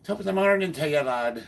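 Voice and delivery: high, loud stage-Irish lilt; bouncy put-on Irish accent. Shown as a demonstration. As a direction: do a hammed-up Irish greeting. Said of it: "Or Irish".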